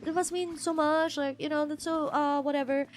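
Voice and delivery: high cooing "aw", gradually tailing off; sympathetic onlooker. Retold or imitated. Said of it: "people will be like".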